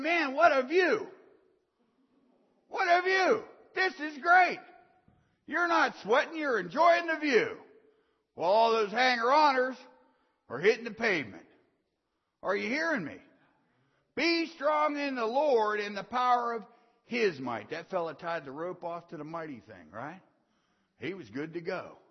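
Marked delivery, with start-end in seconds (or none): 0.0-4.6 lightly voiced climber admiring the view; a hint of a carefree man enjoying the height; mock delighted sigh at the view; relaxed climber. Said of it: "you're up there going". 5.5-17.7 loud, high, hammering sermon delivery; shouting preacher in full flow; full-force preaching. Real, not acted.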